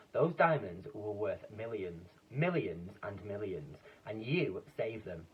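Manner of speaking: slow, weighty praise; slow and solemn. Acted. Duke solemnly telling the animals they saved the diamonds. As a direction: lay each word down with gravity.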